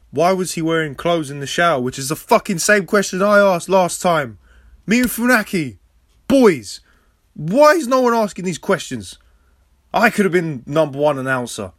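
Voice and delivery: loud, high exasperated rant; outraged rant. Real, not acted. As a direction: yell it in comic indignation. Mock outrage over a repeated question.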